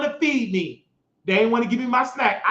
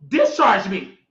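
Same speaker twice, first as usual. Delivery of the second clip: ordinary talk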